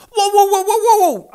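extrem hoch und laut gekreischt; kreischendes Wow. Acted. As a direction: gekreischter Empörungslaut der Göre. Eliza heult empört los. (schrilles Kreischen)

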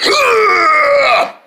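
long, high straining groan; lifting grunt. Pretend. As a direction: heave with a drawn-out groan. Group heaves again.

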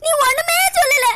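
crying retort. Acted. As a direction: cry it out as you snap back. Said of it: "நீங்க இப்ப அழுதுட்டு இருக்கீங்க". (அழுதுகொண்டே கத்தும் குரல்)

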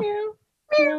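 tiny high-pitched meow; cat meow. Pretend. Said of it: "can you do some meowing".

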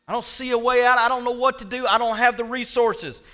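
loud, high-pitched pleading; acted desperate prayer. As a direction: cry out your helplessness to God. Acting out Jehoshaphat's desperate prayer.

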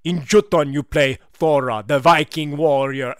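put-on slow, hammy accent of no known country; grand, drawn-out mystery accent; unidentifiable put-on accent. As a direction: declaim it in a muddled foreign accent. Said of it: "I don't even know what this accent is".

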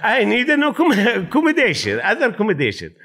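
high, laughing retold protest; half-laughing at his own confusion; laughing indignant complaint. To Charlie, laughing while retelling his protest.

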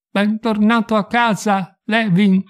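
slow, high, toneless machine voice; impersonal mechanical voice. Acted. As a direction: speak like a home computer greeting. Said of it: "l'impersonale voce meccanica".

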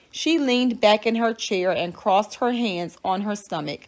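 ordinary delivery

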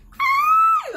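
very high, loud squeal; a piercing happy ay; excited shriek. Real, not acted. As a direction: shriek with excitement before opening it. About to open the big palette.

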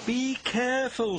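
high-pitched caution; warning. A narrator's reading. Mavis cautions distracted Bill, high warning. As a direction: warn with sudden alarm.

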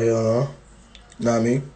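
usual speaking voice

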